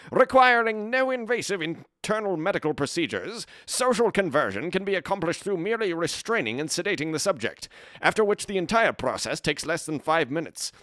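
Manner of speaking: put-on high, punchy old-time news announcer voice; newsreel announcer read. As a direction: read it out like an urgent newsreel bulletin. Reads a news article aloud.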